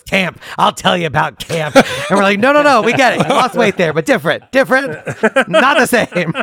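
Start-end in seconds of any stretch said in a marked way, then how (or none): 0.0-1.8 slow, higher grandmother impersonation; measured, emphatic old-relative voice; interrupting grandmother. Retold or imitated. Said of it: "my grandmother out of nowhere just goes". 2.4-6.3 high-pitched mock backpedalling; pleading correction, pitched up for laughs; hasty placating. Family hastily placating the grandmother.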